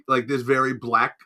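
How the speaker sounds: normal talking voice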